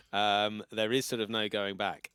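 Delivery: ordinary talk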